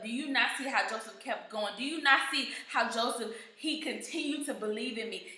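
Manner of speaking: soft voice of God. Retold or imitated. God corrects the preacher.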